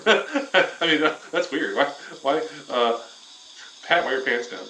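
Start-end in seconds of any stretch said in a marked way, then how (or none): none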